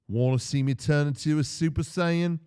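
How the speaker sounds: loud, high hype-guy voice; cheesy anime fan. Retold or imitated. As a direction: hype it up like a cosplayer. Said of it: "a guy really poorly did the effect ... went".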